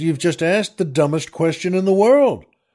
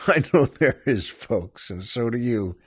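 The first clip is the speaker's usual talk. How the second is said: usual speaking voice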